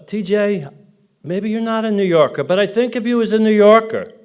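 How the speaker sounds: put-on New York accent. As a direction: play up a Jersey-boy New York accent. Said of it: "my New York accent here a little bit".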